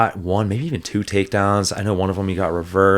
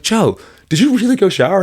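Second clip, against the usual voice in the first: much higher, disbelieving question; mock incredulous; 11 semitones higher, 3 dB louder than usual. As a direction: ask like you can't believe it. Confronting fighter about showering.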